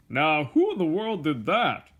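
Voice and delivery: a hint of a gruff watchman calling out; exclaimed. A narrator's reading. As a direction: watchman exclaiming into the dark store. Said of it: "he exclaimed".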